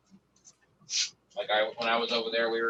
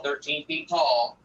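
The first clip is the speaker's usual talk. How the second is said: ordinary talk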